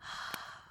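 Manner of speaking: soft, voiceless sigh; contented sigh. Acted. Queen cuddling her sweet boy, contented sigh. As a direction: let out a quiet, settled breath.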